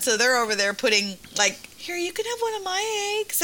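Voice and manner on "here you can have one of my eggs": high, soft little voice; imitated sympathetic kids. Mom imitates kids comforting crying boy, softly sympathetic. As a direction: offer it gently, pitched way up. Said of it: "kids in Roscoe feel bad".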